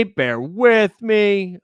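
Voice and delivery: stretched out slow and sing-song; slow drawn plea. Real, not acted. Streamer stalling chat, slow drawn-out plea. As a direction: drag each word out patiently.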